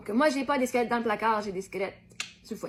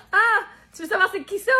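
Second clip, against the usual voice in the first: laughing; 7 semitones higher, 6 dB louder than usual. Acted. Giggling while tickled.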